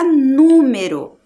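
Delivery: "número" with NU long and loud; stress on first syllable. Shown as a demonstration. Teacher showing the tonic syllable, NU stressed in "número". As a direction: lean hard and slowly on the NU of "número". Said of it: "Pinta a sílaba tônica, que é o nu".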